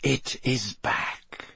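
soft, very slow villain voice; softly. A narrator's reading. Dark lord examines the mark on his servant, softly. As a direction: say it softly, weighing each word. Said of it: "he said softly".

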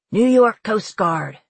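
loud, slow captain's radio call; repeated radio hail. A narrator's reading. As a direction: hail them slowly and loudly. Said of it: "Slate repeated".

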